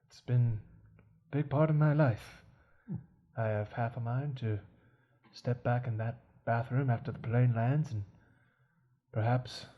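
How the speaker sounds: low, very soft and halting butler; resigned butler, quiet and low, with long pauses; world-weary old servant. Acted. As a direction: speak low and softly, as if giving up. Plays a world-weary suicidal butler in an improv scene.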